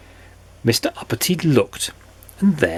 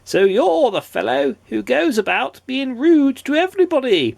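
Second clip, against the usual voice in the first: high, put-on royal goblin voice; goblin king; 13 semitones higher than usual. A narrator's reading. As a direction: size up the visitor as goblin king. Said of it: "said the king".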